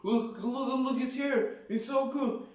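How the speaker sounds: put-on high-pitched, thrilled kid voice; a little kid spotting his hero and pointing; starstruck fanboy kid. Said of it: "so he goes, like".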